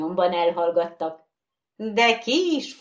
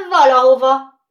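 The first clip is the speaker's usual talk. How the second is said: much louder, high answer; loud reply; 7 semitones higher, 13 dB louder than usual. Acted. Bogeyman answering the horse, loud. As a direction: call it out.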